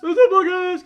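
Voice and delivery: high loud slow announcer yell; catchphrase shout. Acted. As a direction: belt out the catchphrase. Echoing the catchphrase.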